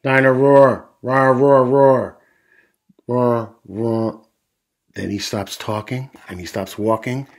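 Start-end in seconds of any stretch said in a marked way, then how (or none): 0.0-4.3 loud roar fading as batteries die; blaring toy voice weakening at the end; toy dinosaur roaring. A narrator's reading. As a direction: roar like a toy, then run down. Toy roars until batteries run out.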